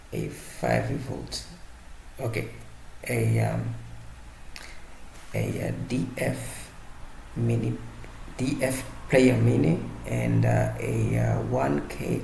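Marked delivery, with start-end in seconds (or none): none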